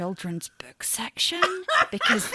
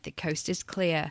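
ordinary talk

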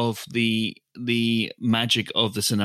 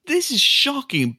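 mock outrage; 9 semitones higher than usual.

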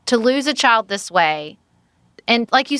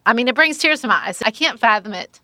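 ordinary talk, nothing marked

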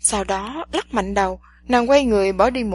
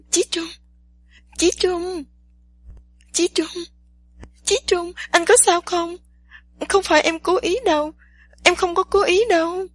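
high, panicked calling out; trembling cry; 8 semitones higher than usual. A narrator's reading. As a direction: call his name in fear. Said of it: "run giọng kêu lên".

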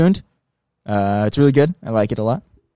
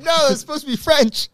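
mocking quote; 14 semitones higher than usual. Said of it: "I was like".

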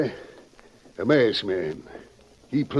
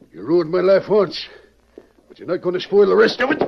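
higher and louder, fast and heated; rising fury, rushed out; angry defiance; 8 semitones higher, 3 dB louder, faster than usual. Acted. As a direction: throw it in the killer's face. Accusing the killer.

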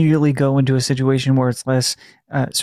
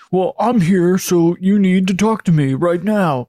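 bold, demanding ghost hunter calling out; 4 semitones higher than usual. Shown as a demonstration. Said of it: "that's my ghost hunter voice".

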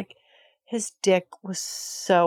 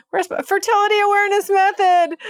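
much higher and louder, a flustered squeal; frantic squeal; 13 semitones higher, 6 dB louder, faster than usual. Real, not acted. Flustered, searching her cycle.

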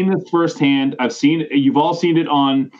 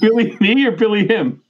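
laughing; 8 semitones higher than usual. Real, not acted. Quoting while laughing hard.

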